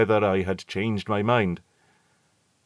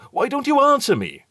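much higher, louder angry demand; sharp, impatient challenge; rough demand; 5 dB louder than usual. A narrator's reading. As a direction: bark the question at the silent steward. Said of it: "I said roughly".

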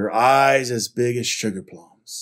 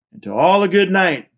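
loud, raised farewell; booming Santa Owl; 8 semitones higher, 10 dB louder than usual. A narrator's reading. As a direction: boom it out to the whole crowd. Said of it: "boomed Santa Owl".

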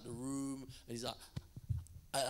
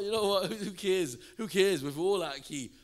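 loud, higher, throwing caution away; carefree exclaiming; 7 semitones higher, 11 dB louder than usual. Retold or imitated. Worshipper deciding bad singing is fine, loud carefree exclaiming. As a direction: blurt it out loudly with abandon. Elated after off-key singing.

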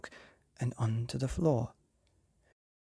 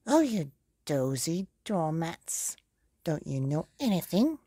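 snarling wicked fairy; 4 semitones higher, 4 dB louder than usual. Acted. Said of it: "snarled the fairy".

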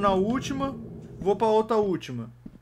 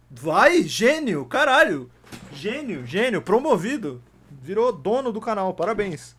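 excited cheer; 6 dB louder, slower than usual. Real, not acted. Streamer celebrates a viewer's tip, excited cheer. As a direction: hail the genius.

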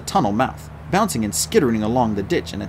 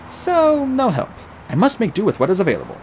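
higher, brisk resolve; determined; 9 semitones higher than usual. Acted. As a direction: pull yourself together quickly. Artemis resolving.

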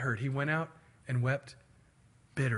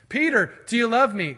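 high, loud, pressing question; acted forceful asking; insistent question; 7 semitones higher, 7 dB louder than usual. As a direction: press him harder, voice raised. Acts Jesus asking a third time.

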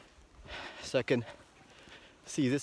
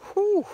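high breathy whew; 10 dB louder than usual. Real, not acted. Winded, needs to get in shape.

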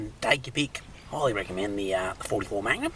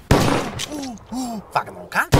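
slow sneer; 9 semitones higher, much slower than usual. Acted. Sneering at the creature.

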